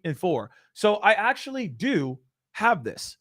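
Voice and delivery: ordinary talk, nothing marked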